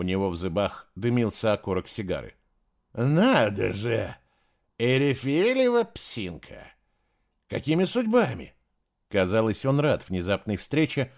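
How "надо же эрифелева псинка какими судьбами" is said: drawling, sneering greeting; mock surprise with a sneer. A narrator's reading. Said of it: "язвит от удивления". (насмешливо, с издёвкой)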